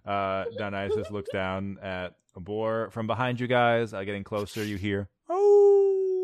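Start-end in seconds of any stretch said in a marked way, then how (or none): 5.3-6.2 shrill distant "Oh!" cry; loud high cry. Acted. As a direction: let out a high wailing cry. Voice heard approaching.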